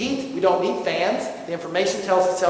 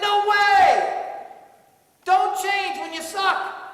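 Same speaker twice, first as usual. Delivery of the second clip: emphatic shout; 9 semitones higher, much slower than usual. Retold or imitated. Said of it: "I said".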